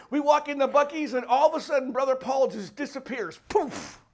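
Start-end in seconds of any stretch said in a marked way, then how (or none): none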